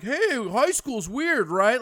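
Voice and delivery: slow, loud, high, hopeful stage bit; bad young comic. Teen comic to bar drunks, slow loud pleading joke. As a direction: drag it out like a green comic begging for a laugh. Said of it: "some fucking eighteen-year-old kid talking to a bunch of drunks".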